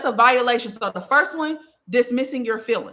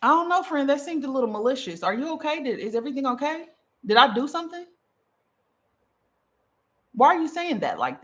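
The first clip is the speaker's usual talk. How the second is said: compassionate undertones; 4 semitones higher than usual. Shown as a demonstration. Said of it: "saying this with compassion".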